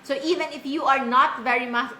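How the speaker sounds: ordinary delivery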